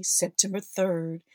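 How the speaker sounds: ordinary talk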